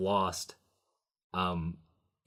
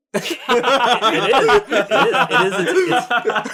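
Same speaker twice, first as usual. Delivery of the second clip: laughing; 14 semitones higher, 10 dB louder, much slower than usual. Real, not acted. Laughing at dub accent.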